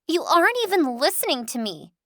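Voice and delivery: high whiny accusation; whiny accusing. Acted. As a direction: accuse her like a spoiled child. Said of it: "she accuses".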